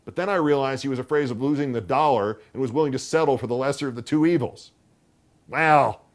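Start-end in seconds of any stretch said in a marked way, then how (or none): 5.5-6.0 a loud, high, irritable "Well". A narrator's reading. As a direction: snap it out, fed up. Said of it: "said Aunt Wilma irritably".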